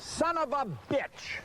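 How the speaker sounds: real rage at the forgotten line; furious curse. Real, not acted. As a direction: spit it out in anger.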